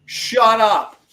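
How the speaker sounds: retold shout. Husband telling off teens in cinema, loud slow retold snap. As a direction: bark it down at the chatty teen. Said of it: "he goes".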